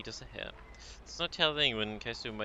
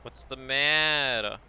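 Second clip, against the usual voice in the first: slow, loud threatening father; menacing father on phone; 3 semitones higher, 7 dB louder, much slower than usual. Acted.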